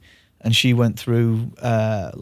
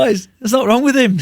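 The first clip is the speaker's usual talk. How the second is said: high-pitched mock mum voice; laughing impression of a protesting mum; imitated exasperated mum; 12 semitones higher, 4 dB louder than usual. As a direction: mimic mum up high, amused.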